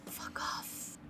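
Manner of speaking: breathless whisper, no voice; whispered in the head. Acted. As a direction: hiss it straight into their mind. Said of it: "you're just gonna hear in your head".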